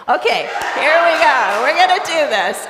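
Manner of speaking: rally the room at full voice; loud rallying. Real, not acted. Preacher pulling congregation back after laughing, loud rallying.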